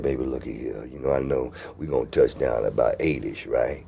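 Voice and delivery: very low and soft phone voice; deep bass voice. Retold or imitated. Man making evening plans with his girlfriend, deep bass voice. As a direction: rumble it quietly, down at the bottom. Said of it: "I'm down deep like a bass".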